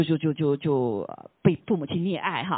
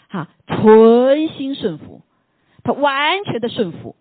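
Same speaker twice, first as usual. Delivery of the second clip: slow, much louder stress on 存心顺服 and 完全的顺服; slow, swelling emphasis on 顺服; emphatic preaching; 4 semitones higher, 10 dB louder, much slower than usual. Real, not acted. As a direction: lean hard on 顺服, drawn out and loud. Preacher stresses Christ's obedience.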